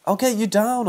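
high, loud yell of rescue. Acted. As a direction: shout it up to him, urgent. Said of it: "I yelled".

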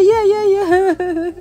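high, drawn-out mock enthusiasm; husband's keen agreement, hammed up high; imitating eager husband. Husband's eager reply, voiced by wife.

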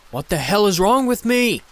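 furious, piercing banshee scream. Acted. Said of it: "I screamed".

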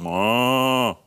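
long drawn-out storybook moo; playful imitated cow lowing. A narrator's reading.

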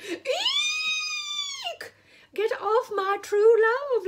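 very high, loud frightened shriek. Acted. Lady shrieking at the eel and fireflies, very high and loud. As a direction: scream it at the bugs. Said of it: "Lady Wendelin shrieked again".

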